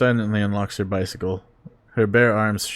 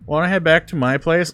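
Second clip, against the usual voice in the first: put-on lightly childish, higher and louder; 8 semitones higher, 6 dB louder than usual. Reader voicing the drunk woman inviting him home, lightly childish. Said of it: "she asks in a childish voice".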